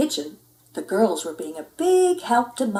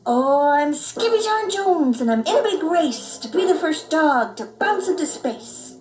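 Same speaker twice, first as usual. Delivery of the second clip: put-on proud kitty boy; 7 semitones higher, 3 dB louder than usual. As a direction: announce yourself like a space hero. Skippy's proud chant.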